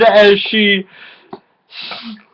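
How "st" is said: ordinary delivery, nothing marked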